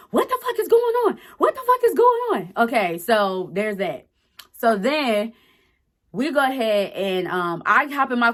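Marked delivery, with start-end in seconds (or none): 0.1-2.5 much higher, frantic sister voice; sister freaking out. Retold or imitated. Sister on the phone at work, panicking, much higher frantic voice. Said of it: "'Cause she's like".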